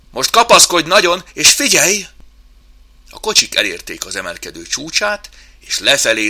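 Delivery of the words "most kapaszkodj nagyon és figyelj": high, loud excited warning; shouting. Acted. Chimp warns mouse before the drop, shouting. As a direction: shout it over the rattling cars. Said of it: "Oszkár kiabálni kezdett".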